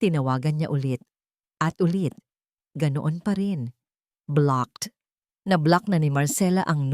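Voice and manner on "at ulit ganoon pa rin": usual speaking voice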